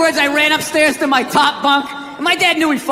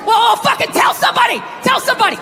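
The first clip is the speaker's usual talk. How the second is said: high, hammed-up exasperated yell; mock frustration, thrown up high; exasperated inner voice; 10 semitones higher than usual. His angry side snapping back, hammed-up high yell. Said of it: "just spitball".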